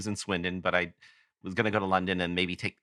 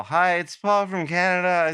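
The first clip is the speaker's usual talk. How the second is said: put-on higher, slower, louder, awkward drunk caller voice; sheepish caller; 8 semitones higher, 5 dB louder than usual. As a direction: play the tipsy fan phoning a star, drawn out. Drunk birthday call to Andy.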